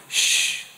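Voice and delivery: long, voiceless "shh"; acted shushing. Jesus silencing his complaining disciples, drawn-out shush. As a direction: hush the grumblers with a drawn-out shush. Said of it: "I imagine Jesus going".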